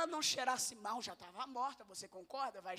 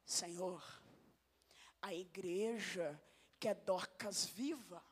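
lower, slow reverent prayer; humble Peter prayer; 3 semitones lower, slower than usual. Retold or imitated. Peter praying for Dorcas, low and reverent. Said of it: "olha Pedro".

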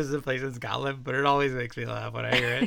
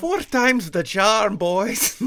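slow, high, loud Scottish brogue; cartoon Scottish; 7 semitones higher, 9 dB louder, much slower than usual. A builder rallies his boys in cartoon Scottish. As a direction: ham up a cartoon Scot. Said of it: "Why is your Scottish accent like a cartoon".

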